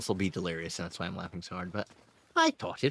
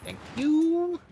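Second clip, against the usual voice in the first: mock shopkeeper; 13 semitones higher, slower than usual. Mock shopkeeper thanks in game.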